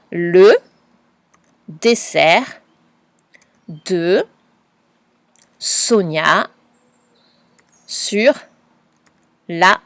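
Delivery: one word at a time with long pauses; each word set apart by a pause; word-by-word dictation. Shown as a demonstration. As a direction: spell out the sentence word by word. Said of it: "Nous corrigeons ensemble".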